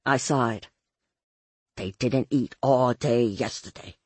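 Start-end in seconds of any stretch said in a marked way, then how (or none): 1.8-4.0 lower-pitched man's voice; Ray. A narrator's reading. Ray explains about his boys, low voice. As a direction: explain in a put-on low male voice. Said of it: "Ray told me".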